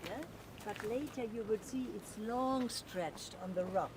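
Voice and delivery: normal talking voice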